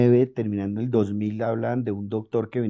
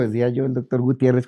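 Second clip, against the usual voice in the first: usual speaking voice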